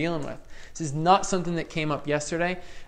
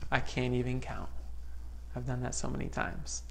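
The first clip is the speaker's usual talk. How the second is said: quiet retold reply; 3 semitones lower, 9 dB quieter than usual. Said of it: "He goes".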